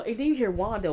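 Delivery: shocked gasp. Real, not acted. YouTuber recapping the show gasps before going on.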